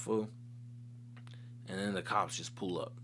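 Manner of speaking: usual speaking voice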